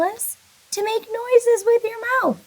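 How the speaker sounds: high, bright teacher excitement; excited. Real, not acted. Teacher thrilling kindergartners about a noise game, high and eager.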